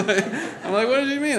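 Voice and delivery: high, loud, laughing self-mockery; real laughing. Real, not acted. Husband retelling first argument with wife, laughing. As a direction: laugh through your own baffled question. Laughing at his own past cluelessness.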